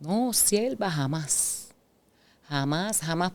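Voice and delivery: softly acted innocent denial, swooping start; flat-out denial. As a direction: deny it softly, mock-innocent. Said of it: "en todo momento lo negó todo". (negación suave, fingiendo inocencia)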